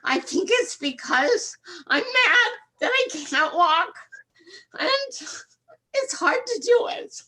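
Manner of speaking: fighting back tears while explaining; choked up, on the verge of crying; tearful. Real, not acted. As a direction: voice breaking with real tears. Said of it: "excuse me if I cry".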